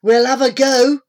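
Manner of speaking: lightly voiced plucky boy; volunteer for a tackle; determined boy. A narrator's reading. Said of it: "said Mick".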